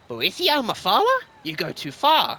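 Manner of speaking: high, loud outraged cry; offended cry. Acted. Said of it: "cried the Chimer".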